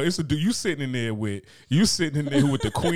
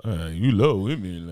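mocking imitation; 4 semitones lower, slower than usual. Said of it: "I love lamp".

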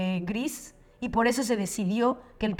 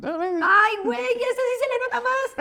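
excited squeal; 12 semitones higher than usual. Real, not acted. Squealing at a dog video.

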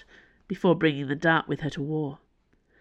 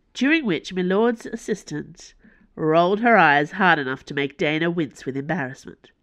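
plain reading voice

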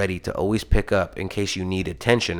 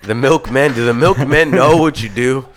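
louder, laughing through the words; 2 semitones higher, 5 dB louder than usual. Real, not acted. Friends joke about naming their fan base, laughing. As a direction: crack up while saying it.

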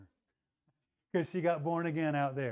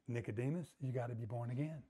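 much softer and lower, gentle; soft Jesus; 7 semitones lower, 12 dB quieter than usual. Acted. Jesus to Nicodemus, softly lower and tender. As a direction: lean in and say it tenderly.

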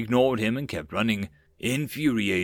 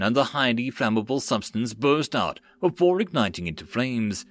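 ordinary delivery, nothing marked

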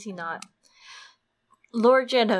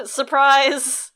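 loud, high, laughing "surprise!"; playful raised cheer; mock surprise; 5 semitones higher, 7 dB louder than usual. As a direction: shout it like a party surprise, through a laugh.

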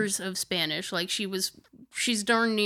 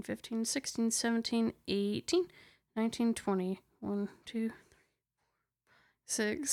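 quiet murmured counting, nearly whispered; counting under breath; 4 dB quieter than usual. Real, not acted. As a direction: count softly to yourself. Counting colours on a picture.

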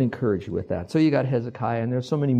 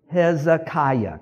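"Hezekiah" slow, syllable by syllable, higher; teaching pronunciation; 4 semitones higher, 4 dB louder, much slower than usual. Shown as a demonstration. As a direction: lead the room through "Hezekiah", drawn out. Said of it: "Say this word with me".

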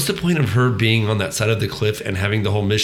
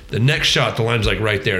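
usual speaking voice

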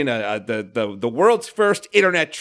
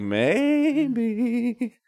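sing-songy maybe; 6 semitones higher, 4 dB quieter than usual. Retold or imitated. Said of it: "then you'd be like".

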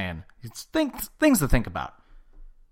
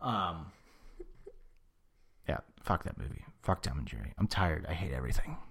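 muttered under the breath, much softer; quiet grumbling rant; 7 semitones lower, 8 dB quieter than usual. Real, not acted. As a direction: grumble it low and soft, like a sulky aside.

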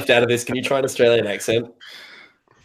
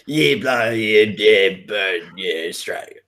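badly faked Aussie babble, trailing off lower; mock Australian accent; 5 semitones lower, slower than usual. Host answering his mate's greeting, mock Australian accent. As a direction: bluff through a rough Australian accent. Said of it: "masterful impersonation of an Australian accent".

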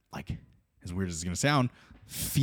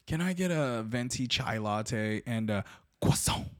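drawn-out, affected coffee order; imitating a pretentious customer, ending on a Frenchified croissant; pretentious Starbucks customer; 3 semitones higher, 4 dB quieter, slower than usual. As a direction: order slowly, savoring every foreign word. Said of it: "the person that goes to Starbucks".

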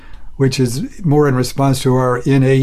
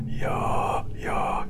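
low, open-throat voiceless whisper; chiaroscuro whisper; 12 dB quieter than usual. Shown as a demonstration. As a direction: whisper with a settled, neutral larynx. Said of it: "have them say".

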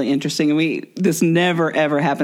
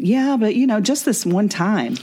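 much higher, slow coaxing plea; tempting inner voice; 7 semitones higher than usual. Retold or imitated. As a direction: wheedle like a little devil.

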